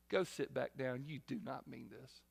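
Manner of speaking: understated mock dismissal; quiet dismissal. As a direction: drop it flat and low. Preacher dismissing the altar call.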